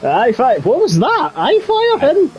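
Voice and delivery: high, hammed-up Scottish accent; co-host copying a pal's Scottish accent, mock Scottish. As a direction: squeak out a jokey Scottish brogue. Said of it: "my attempt at a Scottish accent".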